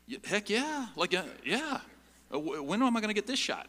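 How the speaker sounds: high-pitched eager yes; excited acceptance. Acted. Young passenger offered the controls by the pilots, excited. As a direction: jump at the chance, voice pitched right up. Acting out his thrilled younger self.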